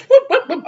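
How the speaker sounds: high whooping siren imitation; mock siren whoop.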